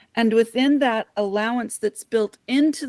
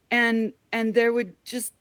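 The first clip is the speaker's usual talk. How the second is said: ordinary talk, nothing marked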